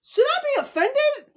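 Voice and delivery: high, laughing disbelief; baffled and amused. Retold or imitated. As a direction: giggle out the question in a squeaky voice. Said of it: "I was just like".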